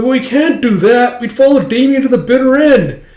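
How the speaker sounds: high, loud mock objection; fervent protest. Boys refusing to leave Damien, fervent mock protest. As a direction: swear loyalty in a hammed-up outcry.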